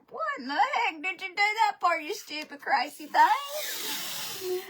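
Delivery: high-pitched, exasperated telling-off of yourself; mock self-scolding. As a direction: scold yourself in comic disbelief, drawing out the end. Retells scolding herself after getting burnt.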